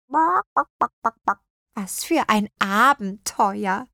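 thrilled hen voice, slowing down; clucking hen. A narrator's reading. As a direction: cluck contentedly over the adventure. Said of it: "gluckste sie".

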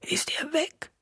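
frail, voiceless whisper; weak whisper. A narrator's reading. Weakened woman asks if he has gone, weak whisper. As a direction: whisper it with no strength left.

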